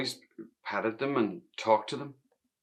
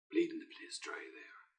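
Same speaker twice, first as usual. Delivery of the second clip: bitter whisper; 11 dB quieter than usual. Retold or imitated. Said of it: "my father would admit no graces".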